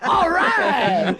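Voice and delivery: hyped wrestler cheer. Retold or imitated. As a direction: cheer like an overexcited tag team. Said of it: "act like they liked each other".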